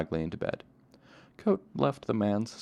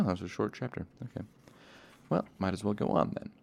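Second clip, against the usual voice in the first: ordinary delivery, nothing marked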